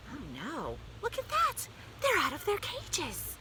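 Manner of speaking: high-pitched, flustered exclamation; startled dismay. A narrator's reading. Teacher finding the pets out of their cages, startled. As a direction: rise from hushed dismay to high alarm.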